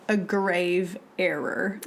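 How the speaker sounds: slow, grave "a grave error"; drawn-out, weighty phrasing; mock solemn. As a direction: say it like a verdict.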